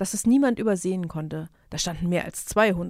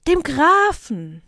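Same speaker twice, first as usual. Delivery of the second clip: higher, louder, slow call across the field; crowd calling out; 8 semitones higher, 8 dB louder, much slower than usual. Acted. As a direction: yell the answer back in chorus. Peasants answer the king.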